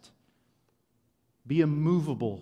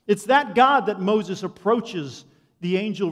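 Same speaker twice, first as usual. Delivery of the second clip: higher and louder, driving proclamation; preaching at full force; 8 semitones higher, 10 dB louder than usual. Acted. Pastor to congregation on God's endless holiness, fervent preaching. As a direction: lift the room, preach it out.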